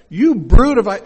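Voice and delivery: booming prophetic rebuke, cut short; fiery preacher's shout. Shown as a demonstration. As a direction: hurl it at the crowd. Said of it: "that's not the way he said it".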